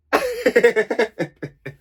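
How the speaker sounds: high scoffing laugh; disbelieving laugh. Real, not acted. Vlogger laughing at Casey promising honesty, scoffing laugh. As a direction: laugh out loud at the idea.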